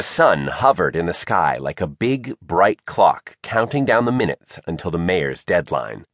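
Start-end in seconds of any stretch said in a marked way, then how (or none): none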